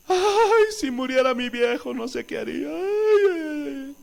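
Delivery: ayes agudos con llanto fingido; imitación de esposa llorosa. Retold or imitated. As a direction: imita a la esposa que lloriquea por su viejo. Pastor imita a esposas llorando. (imitando un lamento lloroso)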